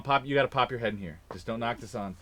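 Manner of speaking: normal talking voice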